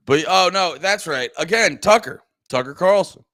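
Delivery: sudden recall. Real, not acted. Suddenly remembering Tucker.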